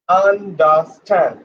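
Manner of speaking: UNDERSTAND slowly as an-da-stand, three parts; no R sound. Shown as a demonstration. Teacher showing students there is no R, said an-da-stand. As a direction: drop the R in UNDERSTAND, syllable by syllable. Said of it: "There is no R".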